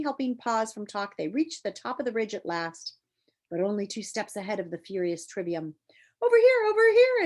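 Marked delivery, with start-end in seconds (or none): 6.2-7.2 very high, loud guiding call. Acted. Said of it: "advised the voice".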